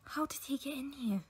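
alarmed hushed whisper. Acted. Student asking her friend about the intruder, alarmed whisper. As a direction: whisper so the stranger won't hear. Stranger appears suddenly.

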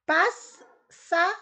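"Pássaro" split into pás, sa, ro with long pauses; "Pássaro" broken up for dictation; syllable by syllable. Shown as a demonstration. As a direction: say "Pássaro" loudly and slowly, each syllable apart. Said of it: "Separe as sílabas das palavras".

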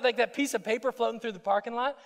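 ordinary delivery

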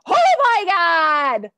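slow, loud, high shocked cry; a loud, drawn-out holy hell; shocked outcry. Retold or imitated. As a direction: cry out in stunned disbelief. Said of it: "Are you guys like".

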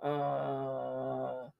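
long, low, soft groan; imitated groan. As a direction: mimic a drawn-out moan, quietly. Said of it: "a, a groaning sound".

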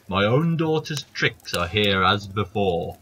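slow, loud grumbling giant; thwarted giant complaining. Acted. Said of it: "said the giant".

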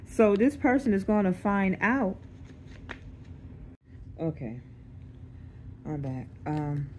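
ordinary talk, nothing marked

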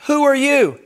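high, loud startled cry. Retold or imitated. Listener startled by the new voice inside, crying out high and loud. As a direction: jump back in alarm.

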